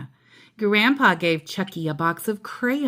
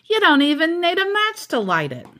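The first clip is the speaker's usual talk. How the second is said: lightly voiced proud dad, a bit higher; dad Stu, proud; 7 semitones higher, 3 dB louder than usual. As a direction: brag about the new charcoal.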